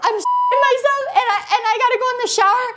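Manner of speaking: high-pitched mock wail; retold tearful whine. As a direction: blurt it out high, as if crying. Acts out her own tearful confession.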